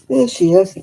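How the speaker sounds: loud greeting. Real, not acted. Greeting pet loudly.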